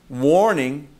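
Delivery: ordinary talk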